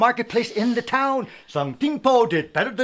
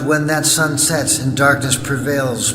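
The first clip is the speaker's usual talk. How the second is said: spooky ride narrator; 7 semitones lower, 5 dB louder than usual. Acted. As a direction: lure the listener into the dark. Spooky attraction narration with music.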